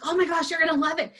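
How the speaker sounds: thrilled friend cheering her on; imitating a thrilled judge friend; excited. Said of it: "He was so excited".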